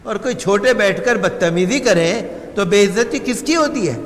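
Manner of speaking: ordinary delivery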